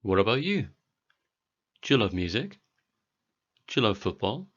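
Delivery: ordinary talk, nothing marked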